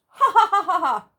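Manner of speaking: high, loud ha-ha-ha belly laugh; Mister Happy laughing loud and high; hearty laugh. Acted. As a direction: laugh it out big and jolly. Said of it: "He laughed".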